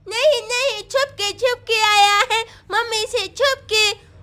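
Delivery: put-on babyish, halting little-boy talk; toddler. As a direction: confide like a tiny child. Toddler explains he sneaked out. (छोटे बच्चे की तोतली आवाज़)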